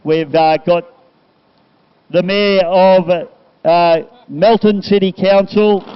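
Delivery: loud PA announcer; big live commentary-box voice; event announcer. Real, not acted. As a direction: address the crowd loudly, warm thanks to the crew.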